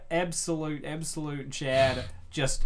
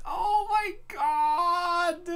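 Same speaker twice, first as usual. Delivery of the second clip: higher, drawn-out squeal of delight; delighted gush; much slower than usual. Real, not acted. Gushing over Rosalina in the chef outfit, drawn-out squeal. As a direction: melt over the cuteness.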